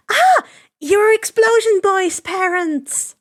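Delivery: high, loud squeal of recognition; excited squeal. Acted. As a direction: shriek it out, delighted, slowly on the second line. Himiko recognizes Bakugo's parents.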